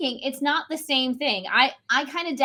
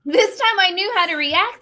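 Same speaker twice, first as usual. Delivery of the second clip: laughing; 7 semitones higher, 5 dB louder than usual. Real, not acted. Laughing while telling story.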